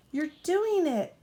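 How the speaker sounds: little engine cheering on his friend, high; peeped. A narrator's reading. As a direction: peep it out high with delight. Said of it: "peeped Thomas".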